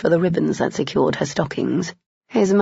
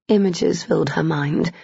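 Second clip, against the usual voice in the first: plain reading voice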